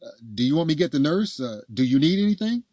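high, loud, anxious-to-help apology; flustered apology. A narrator's reading. Man apologizing to his partner in labor, flustered.

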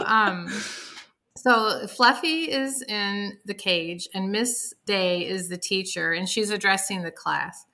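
normal talking voice

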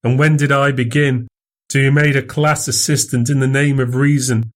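rising, pleading Fettes, pitch climbing; frightened student crying out; a touch of anguish. A narrator's reading. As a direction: protest his innocence, growing desperate. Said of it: "he cried".

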